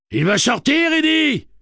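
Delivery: high, loud warning yell; panicked full-voice shout; alarmed cry. A narrator's reading. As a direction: scream an urgent warning. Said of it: "cria Tian".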